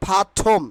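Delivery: "perform" said pha-phom, r dropped, puffed p; breathy burst on the p of "perform", no r; corrected pronunciation. Shown as a demonstration. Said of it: "it will be".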